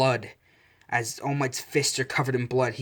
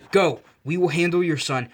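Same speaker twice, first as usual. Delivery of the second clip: urgent shout; 6 semitones higher, 8 dB louder than usual. A narrator's reading. Police officer to All Might, an urgent shout. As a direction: shout it higher and louder.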